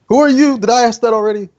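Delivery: put-on high, loud befuddled drunk; confused drunk. Game master voicing drunk mage, high confused squeak. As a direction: squeak out the forgotten question, tipsy. Drunk NPC forgets he asked.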